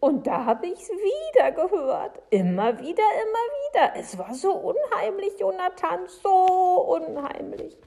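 high, loud, frightened insistence; spooked Frau Segebrecht. Acted. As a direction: cry out how eerie it was.

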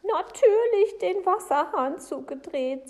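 high, loud interruption; agitated Frau Segebrecht. Acted. Upset woman cuts him off.

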